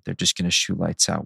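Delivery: ordinary talk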